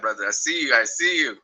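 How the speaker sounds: ordinary talk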